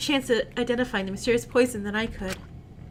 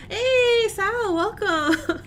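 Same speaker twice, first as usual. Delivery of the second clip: cheery greeting; 8 semitones higher, much slower than usual. Acted. Character greets newcomer.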